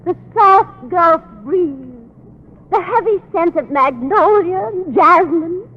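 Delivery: slow, lingering romantic reverie; slow dreamy romantic drift. Acted. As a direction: savor each image slowly. Dreamy romantic scene painting.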